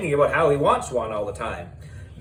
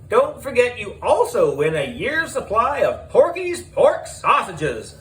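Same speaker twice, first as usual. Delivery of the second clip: higher, cheery announcer plug; upbeat host; 8 semitones higher than usual. Acted. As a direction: sell the sausages like a TV host. Host Miss Beard adds a prize.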